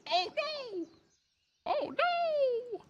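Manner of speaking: very high falsetto oh no; dismayed cry. Real, not acted. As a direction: yelp in mock panic. Game mishap cry.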